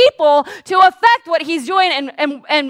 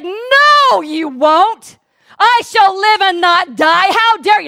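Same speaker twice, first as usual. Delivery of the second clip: loud, higher defiant declaration; fierce rebuke; defiant shout; 6 semitones higher, 6 dB louder, slower than usual. Retold or imitated. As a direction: stand up and refuse him. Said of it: "and I said".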